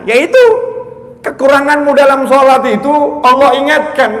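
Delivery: loud, high-pitched sermon warning; fiery preacher rebuking the congregation; forceful preaching. Acted. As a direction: preach it hard at the husbands.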